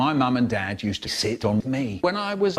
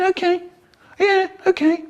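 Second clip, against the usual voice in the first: squeaky okay. Acted. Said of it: "I said".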